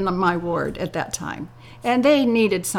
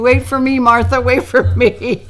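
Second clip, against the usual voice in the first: put-on louder call of a hurrying old lady; 3 semitones higher, 7 dB louder than usual. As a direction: call after your friend with the walker. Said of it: "the other one was saying".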